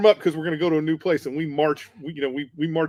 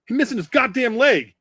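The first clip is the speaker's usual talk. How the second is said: a higher-pitched, fed-up retort; exasperated corporal; 9 semitones higher, 4 dB louder than usual. Retold or imitated. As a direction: snap back at them in disbelief. Corporal rebukes the jeering Marines.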